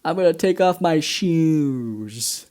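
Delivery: slow, stretched-out mock intoxication; drugged partygoer. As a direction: drag the words out as if tripping. Drugged man at a party, acted.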